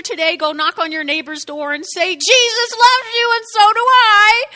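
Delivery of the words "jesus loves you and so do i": high, loud, hammed-up declaration; overzealous proclaiming. Knocking on a neighbor's door to witness, overzealous proclaiming. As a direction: proclaim it like a pushy doorstep evangelist. Mock doorstep evangelizing to neighbor.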